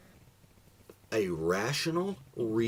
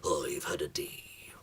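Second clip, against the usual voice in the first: pirate voice; 5 semitones lower than usual. Acted. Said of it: "he says".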